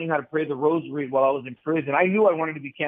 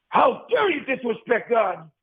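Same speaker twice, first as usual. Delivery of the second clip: slow, higher-pitched indignant rebuke, only mildly raised; mock scolding yell; 4 semitones higher, slower than usual. Priest rebuking a man in confession, retold indignant scolding. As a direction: imitate a priest's stern telling-off, drawing it out. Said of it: "he had to yell at me".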